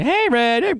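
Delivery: high sing-song "Hey, Red"; high bird call voice. Shown as a demonstration. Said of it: "Go up and talk to Red. Say".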